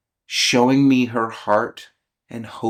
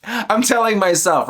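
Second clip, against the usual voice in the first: high, raised voice with a laugh; mild self-directed yell, pitched up; 10 semitones higher, 4 dB louder than usual. Real, not acted. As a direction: scold yourself loudly, half laughing. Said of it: "I'm yelling at me".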